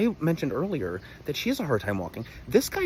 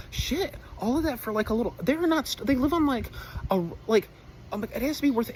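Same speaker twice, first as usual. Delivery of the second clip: worked-up rant; 12 semitones higher than usual. Real, not acted. Ranting about parents' farm.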